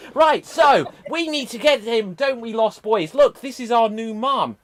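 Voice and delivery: high, loud pantomime hero; eager boy hero. Acted. Peter introduces Wendy to Lost Boys, eager boy hero.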